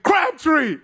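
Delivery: high-pitched mock shout; Richard Sherman screaming. Player calls out rival receiver, mock screaming. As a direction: yell the rival's name like a wrestling promo. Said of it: "Richard Sherman screaming at her".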